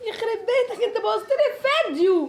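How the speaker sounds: high laughing outburst. Real, not acted. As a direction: crack up in disbelief. Video ruined, both laughing.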